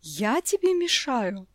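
slow, breathless indignant question; choking with anger. A narrator's reading. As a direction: gasp it out in outrage. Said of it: "задохнулась в гневе". (жена задыхается от гнева)

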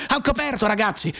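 alarmed yell to the squad; corporal's cry. Acted. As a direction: call the men to cover. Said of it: "il caporale gridò".

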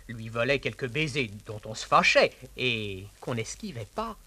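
usual speaking voice

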